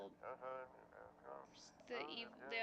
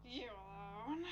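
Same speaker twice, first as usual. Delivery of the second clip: creepy voice; 8 dB quieter, slower than usual. Acted. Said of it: "creep your voice for him".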